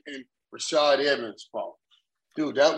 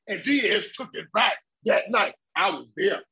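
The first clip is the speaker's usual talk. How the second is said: ordinary talk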